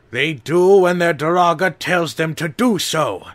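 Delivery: loud, high, firm order; commanding shout. A narrator's reading. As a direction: command the heroes to obey. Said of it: "voice struck the Toa like a hammer blow".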